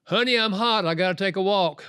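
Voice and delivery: acted urgent heads-up to a wife; urgent warning. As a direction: voice a husband heating up. Voicing husband about to lose temper.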